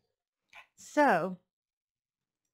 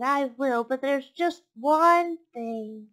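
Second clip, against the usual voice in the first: higher, louder piglet voice; reluctant piglet; 4 semitones higher, 6 dB louder, slower than usual. Acted. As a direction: stall for time as the piglet. Piglet bargains with the fox.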